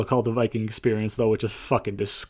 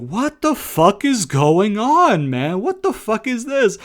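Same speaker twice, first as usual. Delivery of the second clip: high, slow and drawn-out disbelief; exasperated outburst; 8 semitones higher, 3 dB louder, slower than usual. Retold or imitated. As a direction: stretch out the what-the-hell like it's too much. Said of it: "M V P's like".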